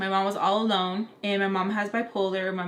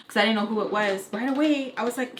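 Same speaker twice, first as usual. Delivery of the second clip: usual speaking voice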